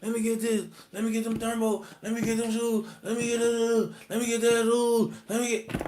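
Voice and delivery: a hint of a crowd of eager beggars; begging inmates. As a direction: pester him like everyone grabbing for stuff.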